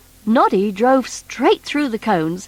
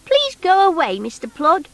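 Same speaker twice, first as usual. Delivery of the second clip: put-on high, bright little toy boy; Noddy's voice; 7 semitones higher than usual. Noddy asking the strange creature, high boyish voice. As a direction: pitch up as young Noddy.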